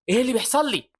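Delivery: high-pitched horrified question; terrified. A narrator's reading. Andreas as his body breaks apart, terrified. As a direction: cry out in rising terror. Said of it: "فبيقول برعب".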